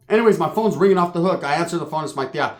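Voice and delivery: usual speaking voice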